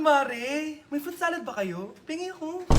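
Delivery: usual speaking voice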